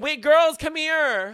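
high and loud, calling across. Real, not acted. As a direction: call them over from the next room. Calling girls from another room.

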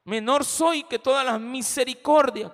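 preacher quoting Jacob's prayer aloud; humble loud prayer. Acted. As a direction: proclaim it to God. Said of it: "se puso a orar".

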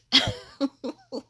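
short loud high laugh; laugh of disbelief. Real, not acted. Reacting to the online brides, loud high laugh.

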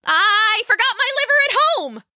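high, loud desperate rabbit; yelling rabbit. A narrator's reading. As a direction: blurt out the lie. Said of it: "she yelled".

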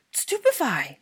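high, very loud spell cry; shriek. A narrator's reading. Frantic Hermione stunning the man, shrieking. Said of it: "she shrieked".

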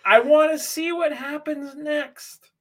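high, loud mock whine; whiny audience voice. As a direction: complain like a let-down moviegoer. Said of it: "And everybody's like".

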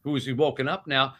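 normal talking voice

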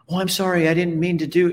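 high, loud, flustered apology; panicked apology. Acted. Punchinella apologizes to the toy maker, flustered and high. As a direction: blurt it out as an anxious little toy. Acted frightened apology in story.

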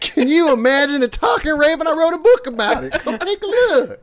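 peddle the book like a Southern hawker; Southern Poe. Acted. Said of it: "Edgar Allan Poe if he was from the Deep South".

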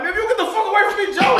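very high, rapid-fire mock tirade; loud angry demo. Shown as a demonstration. Showing Joe the loud he means, mock angry. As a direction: rush it out high, as if fed up. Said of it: "that's the loud I'm doing".

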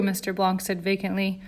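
usual speaking voice

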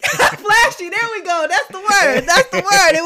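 laughing, excited agreement; delighted. Real, not acted. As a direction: cheer the word on.

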